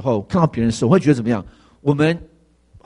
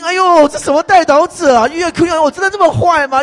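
high, loud indignant shock; retelling a pastor's wife's shocked outburst; shocked outcry; 5 dB louder than usual. Retold or imitated. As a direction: cry out in hurt disbelief. Sermon retells shocked wife's reaction.

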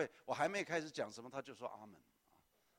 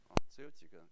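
normal talking voice

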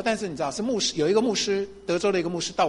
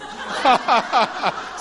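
amused laugh; 5 semitones higher, 3 dB louder than usual. Real, not acted. Laughs at own retort.

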